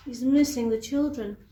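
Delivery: ordinary delivery, nothing marked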